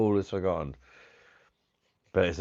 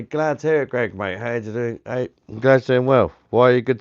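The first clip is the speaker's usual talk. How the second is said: loud cheery greeting; 5 semitones higher, 8 dB louder than usual. Real, not acted.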